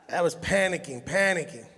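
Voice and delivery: usual speaking voice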